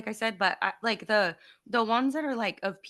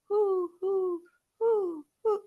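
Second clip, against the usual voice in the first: put-on high, repeated hoo noises like a toddler; obnoxious hooting; 10 semitones higher, much slower than usual. Mom imitating son's obnoxious hooting in the car. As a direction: hoot like an annoying little boy. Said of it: "being obnoxious".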